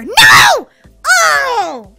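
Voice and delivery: high, loud cry of defeat; dismayed shout. Real, not acted. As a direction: yell as the jump goes wrong. Fails a jump in game.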